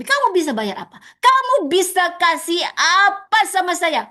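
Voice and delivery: greedy mentor. Retold or imitated. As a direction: squeeze the student for payment.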